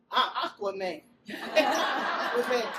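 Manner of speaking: put-on proud boy laughing through the word. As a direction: laugh out a triumphant boast. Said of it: "he said".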